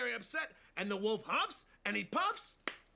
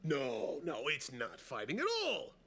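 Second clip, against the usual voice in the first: ordinary talk, nothing marked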